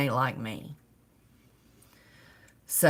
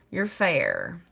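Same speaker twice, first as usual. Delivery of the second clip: ordinary talk, nothing marked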